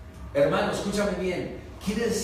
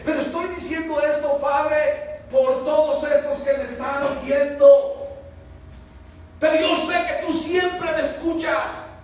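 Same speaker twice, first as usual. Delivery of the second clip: declaring aloud; 8 semitones higher, 6 dB louder than usual. Retold or imitated. Jesus speaking aloud for the crowd.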